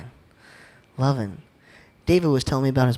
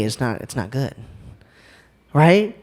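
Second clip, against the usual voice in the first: ordinary talk, nothing marked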